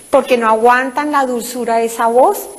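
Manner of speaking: normal talking voice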